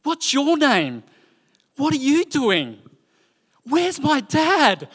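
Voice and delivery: put-on high, slow, chirpy little-girl voice; imitating a bold, friendly small child; little girl Asher. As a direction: bounce up to strangers like a five-year-old. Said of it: "she'll be going".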